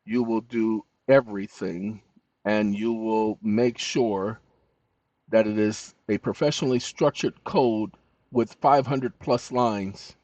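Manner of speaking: slow, low dictated command; dictation voice. Real, not acted. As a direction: spell out orders slowly to a machine. Dictating orders to chatbot.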